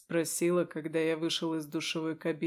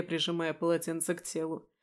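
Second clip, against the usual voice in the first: ordinary delivery, nothing marked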